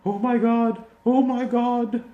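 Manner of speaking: high, hammed-up mock British accent; cheesy British accent. Reviewer mocking the actress's cheesy British accent, hammed-up. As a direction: mimic the actress's over-the-top British accent. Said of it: "Sarah Paulson has this really cheesy British accent".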